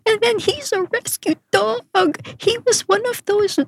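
relaying the owners' news gently; a touch of grief. Retold or imitated. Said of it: "sabi nila".

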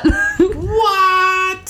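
high wailing sung-out what; shocked what. Real, not acted. Host wails at cohost's news in shock. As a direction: stretch it in mock disbelief.

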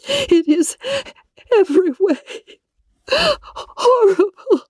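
high, tearful, breaking on the last word; despairing, dragging out horrible; Elizabeth in despair. Acted. Elizabeth telling Darcy nothing can be done, despairing.

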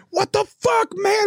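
high-pitched mock yell; vehement screaming. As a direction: imitate an outraged construction worker. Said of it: "vehemently screaming at me".

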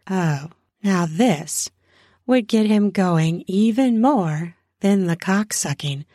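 ordinary delivery, nothing marked

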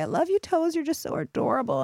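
high, doting coo to your own toes; tender self-love. Shown as a demonstration. Coach showing listeners self-love talk, high doting coo. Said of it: "gently touch your toes and say".

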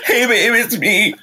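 high, shaky goodbye call; a hint of panicked goodbye; tearful voicemail. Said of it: "leaving an emergency voicemail".

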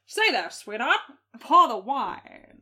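put-on high, loud, slow old-time drawl; hammed-up gangster charm; 1930s voice. Mock 1930s tough-guy line.

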